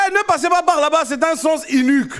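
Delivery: loud, high-pitched imitation of a Congolese accent. Congolese local warning a visitor off a one-way street, shouted in Congolese accent. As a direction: Congolese accent, swapping the I and U in "unique". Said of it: "vous mettez I à la place de U et U à la place de I".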